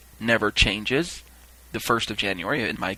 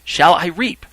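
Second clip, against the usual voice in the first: higher, louder questioning call; 5 semitones higher, 5 dB louder, slower than usual. Retold or imitated. Reaper asking a second time, calling out.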